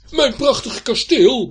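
put-on loud outraged old knight, rising high; indignant cry. A narrator's reading. Knight hears his castle called a ruin, indignant shout. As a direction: exclaim in wounded outrage. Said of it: "verontwaardigd te roepen".

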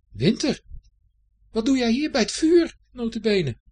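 loud, high-pitched astonished outburst; indignant surprise. Acted. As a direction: call out, scandalised to catch them there. Herfst finds Winter by the fire. (luid en hoog, verontwaardigd verbaasd)

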